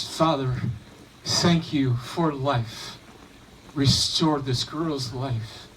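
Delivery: put-on Jesus holding the girl up in prayer; solemn prayer. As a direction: pray it slowly up to heaven. Jesus prays over dead girl.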